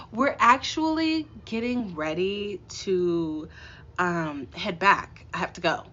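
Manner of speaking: slow and halting, stalling with um; slow awkward brush-off with a long um; slow, hesitant. Retold or imitated. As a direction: drag it out awkwardly to get away. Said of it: "And that's how I said it".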